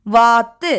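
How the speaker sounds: "வாத்து" drawn out and split at a held dental t; doubled consonant demo. Shown as a demonstration. Teacher showing children where the sound comes, split-word demo. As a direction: lengthen வா in "வாத்து", hold த், then release. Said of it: "இது இத்து sound வரும்".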